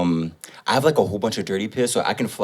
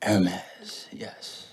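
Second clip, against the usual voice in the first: whispering; 2 semitones lower, 8 dB quieter than usual. Real, not acted. Whispered aside.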